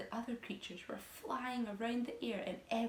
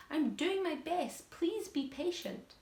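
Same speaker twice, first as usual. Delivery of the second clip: pleading glowworm; 8 semitones higher, slower than usual. A narrator's reading. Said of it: "answered the poor glowworm".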